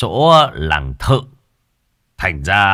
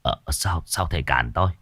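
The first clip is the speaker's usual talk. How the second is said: soft, hesitant question; a quiet bewildered ask; puzzled; 3 semitones lower, 8 dB quieter than usual. Acted. Chief asks why the master stops him, soft puzzled.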